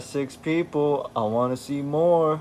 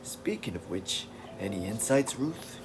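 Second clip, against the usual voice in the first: Beast; 2 semitones lower, 6 dB quieter than usual. Acted. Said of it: "So he's always like".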